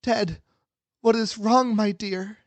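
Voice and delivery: high-pitched voice of a worried mom; concerned mother. Acted. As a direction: ask it high, as an anxious mother. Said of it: "his mother concernedly said".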